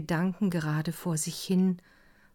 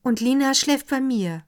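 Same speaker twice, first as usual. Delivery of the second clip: put-on higher, louder young woman announcing; higher announcing young lady; 7 semitones higher, 7 dB louder than usual. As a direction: declare it firmly. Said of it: "verkündete Fräulein Undarn".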